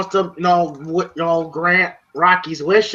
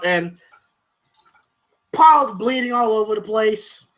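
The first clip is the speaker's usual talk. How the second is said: ordinary delivery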